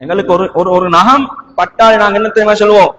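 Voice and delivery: normal talking voice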